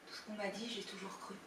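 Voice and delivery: very soft, subdued admission; hushed and defeated; resigned confession. Acted. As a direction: confess it quietly, almost to yourself. Confesses to always being fooled.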